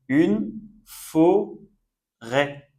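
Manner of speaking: FORÊT split slowly as "fo... rè"; syllable by syllable. Shown as a demonstration. Teacher giving another example of ê, "forêt" slowly in syllables. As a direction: draw out "forêt", ending on open "è". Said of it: "on prononce est".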